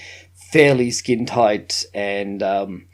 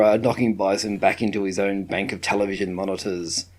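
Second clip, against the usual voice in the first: normal talking voice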